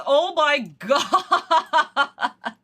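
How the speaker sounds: drawn-out words dissolving into laughter; exasperated laughter. Real, not acted. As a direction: laugh helplessly through the disbelief.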